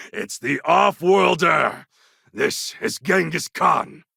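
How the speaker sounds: a warlord trumpeting his own name; proud boast. Acted. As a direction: announce yourself with swagger. Proud announcement of himself.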